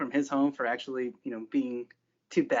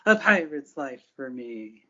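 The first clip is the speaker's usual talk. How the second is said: slow pirate oath, a bit louder; slow raised vow; 3 dB louder, slower than usual. Acted. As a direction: draw it out like a rallying cry. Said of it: "raises her staff in the air".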